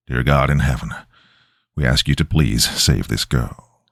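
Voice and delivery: low, earnest plea; grave man begging God; exorcist's prayer. A narrator's reading. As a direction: pray low and solemn. Mike prays over possessed girl.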